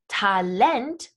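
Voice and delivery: "Talent" said the German way, ta-LENT; second-syllable stress on Talent. Shown as a demonstration. Teacher introducing a loan word, "Talent" stressed ta-LENT. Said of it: "being emphasized on that second syllable".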